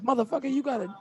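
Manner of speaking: high-pitched mock urging; imitated cornerman. Imitating a corner giving his fighter a talking-to, high mock urging.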